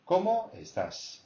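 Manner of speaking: Cómo high, estás falling away; high-pitched CÓMO. Shown as a demonstration. Teacher models the whole greeting, CÓMO high-pitched. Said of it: "high pitch because it's a question".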